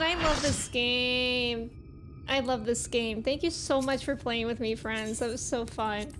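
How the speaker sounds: ordinary talk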